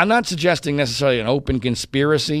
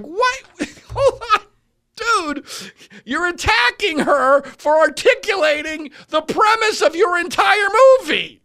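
high-pitched, exasperated shouting; incredulous outburst; 3 dB louder than usual. Retold or imitated. Host retelling his reaction to Dan Reed's attack, incredulous outburst. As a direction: protest in disbelief, voice pitched way up.

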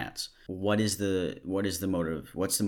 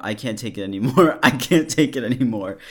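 louder and higher, breaking into a laugh; building mock outburst; 4 semitones higher, 8 dB louder than usual. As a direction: ham up his despair, cracking up. Repeats the message louder, laughing.

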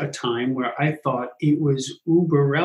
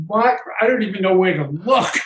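mock bewilderment; 3 semitones higher than usual. Listener bewildered by the chaos.

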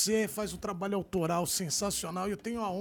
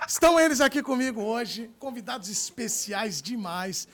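announcer; 7 dB louder than usual. Acted. Host announcing guests.

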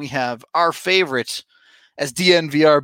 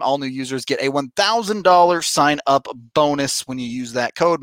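ordinary talk

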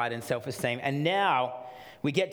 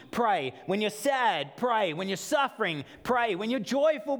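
higher, emphatic punch on each pray; building preaching refrain; 8 semitones higher than usual. Acted. Pastor urging congregation to pray, rising fervor. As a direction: drive the refrain home.

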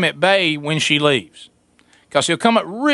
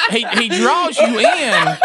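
usual speaking voice

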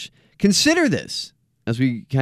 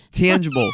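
tangible pitched up as a word on its own; stress on tangible; 4 semitones higher than usual. Real, not acted. Host jokes about font size, stress on tangible. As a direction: repeat tangible higher, as if in big type. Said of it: "I want to stress the word tangible".

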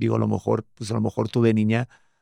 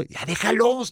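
much higher-pitched mock pleading; exasperated nagging; 10 semitones higher, much slower than usual. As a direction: imitate a wife's exasperated plea. Imitates wife nagging about others' wedding.

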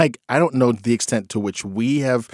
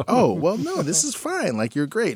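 reassuring dad; 9 semitones higher, 3 dB quieter than usual. Retold or imitated. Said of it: "And I was like".

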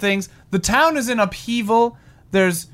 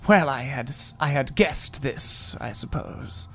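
low quiet resigned; 8 semitones lower, 7 dB quieter than usual. Acted. Tobit resigned.

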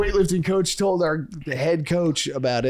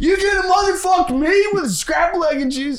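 high, loud shouted coach rant; yelling coach; 13 semitones higher, 4 dB louder than usual. Retold or imitated. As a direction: scream it like a furious coach. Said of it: "that's it".

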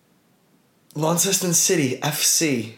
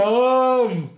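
cheer; 12 semitones higher, 3 dB louder than usual. Real, not acted. Echoing the cheer.

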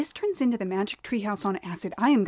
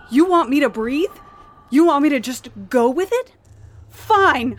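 defiant; 4 semitones higher, 7 dB louder than usual. Acted. Talking back to voice.

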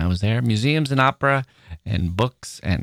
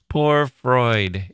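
normal talking voice

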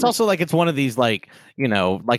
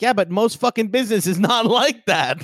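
higher, exasperated retort; 8 semitones higher than usual. Retold or imitated. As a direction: scoff at the corporate spin. Mocking reply to corporate line.

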